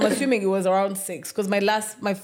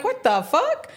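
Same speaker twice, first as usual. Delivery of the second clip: high-pitched exasperated outburst; exasperated disbelief; 12 semitones higher, slower than usual. Retold or imitated. Retelling tokens refusing to load, exasperated. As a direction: act out indignant disbelief. Retold failed token loading.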